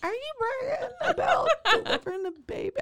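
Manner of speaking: high, laughing mock outrage; laughing disbelief. Friend mocking the bragging doctor, high and laughing. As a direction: tease him through laughter.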